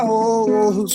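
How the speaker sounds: normal talking voice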